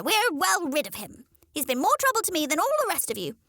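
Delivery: high, fast, fed-up hen; dismissive mother hen. Acted.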